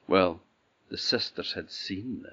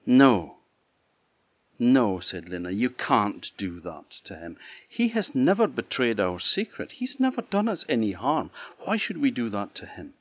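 pleading; 4 semitones higher, 6 dB louder than usual. Acted. Lena pleads for the gardener.

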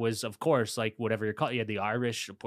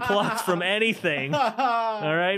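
mock put-down; 5 semitones higher than usual. Acts out putting dreamer Martha down.

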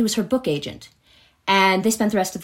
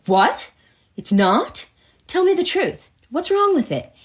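high, insistent pleading; much higher, pressing anxiously for the truth; mock worried husband; alarmed husband; 11 semitones higher, 3 dB louder than usual. Husband panics about diamond.

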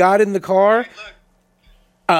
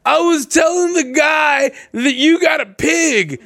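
put-on high, slow drunken holler; imitating drunk caller; 8 semitones higher, slower than usual. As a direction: drag it out like a hammed-up drunk. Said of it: "the problem with drunk people when they call you".

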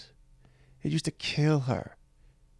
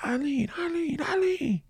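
cheering crowd call; slower than usual. Retold or imitated. Homeless guys cheering for mom.

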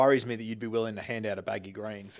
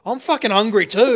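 loud, high mock protest; mock angry outburst; 9 semitones higher, 9 dB louder than usual. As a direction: blurt it out as a comic complaint. Acting out his hungry protest.

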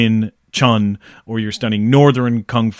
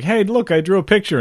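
put-on higher, childish eager voice; little kid; 7 semitones higher than usual. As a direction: show off like a small boy. Voicing the son.

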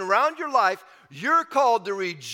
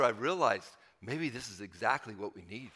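normal talking voice

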